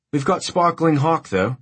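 Cockney-tinged Australian waitress; bright high Australian barmaid voice; Australian accent. A narrator's reading. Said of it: "in that Australian accent that is Cockney".

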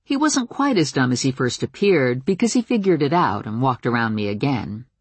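usual speaking voice

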